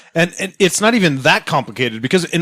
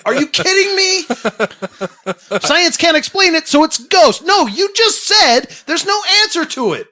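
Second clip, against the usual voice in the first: incredulous rant. Real, not acted. Ranting about ghost hunters in high disbelief. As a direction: throw up your hands in disbelief.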